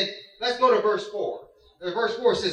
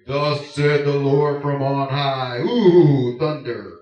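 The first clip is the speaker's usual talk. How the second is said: thundering God; 7 semitones lower, 5 dB louder, slower than usual. Pretend. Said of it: "you don't hear".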